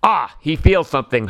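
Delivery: ordinary delivery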